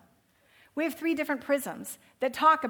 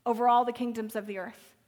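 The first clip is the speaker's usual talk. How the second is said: fervent prayer; 4 semitones higher, 6 dB louder than usual. Acted. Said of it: "and goes".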